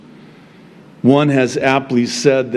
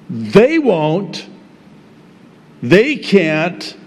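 ordinary talk, nothing marked

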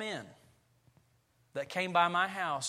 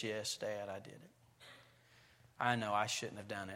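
lower, softer, guilty mumble; sheepish son; 9 semitones lower, 7 dB quieter than usual. Retold or imitated. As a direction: confess like a caught teenage boy. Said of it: "and I say".

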